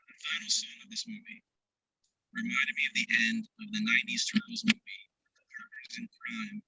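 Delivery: garbled, muffled bad connection; underwater. Real, not acted. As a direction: voice breaking up like underwater. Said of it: "you sound like you're underwater".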